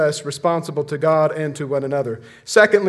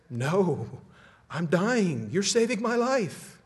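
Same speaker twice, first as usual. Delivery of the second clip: pleading rattlesnake; 3 semitones higher, 4 dB quieter, slower than usual. Acted. Dying rattlesnake begging the boy to trust it, pleading. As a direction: plead as the snake, pitch rising.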